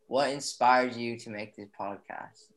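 ordinary delivery

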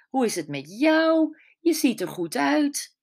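high, lively friendly questions; chatty saleswoman. Acted. Saleswoman chatting up the writer, high and lively. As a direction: gush a little over seeing her. Cheerful saleswoman greets narrator.

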